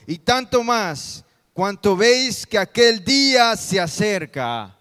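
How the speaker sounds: loud, emphatic Bible reading with drawn-out phrases; scripture reading. Acted. Pastor reads Hebrews aloud with the congregation, emphatic scripture reading. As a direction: proclaim the verse to the congregation.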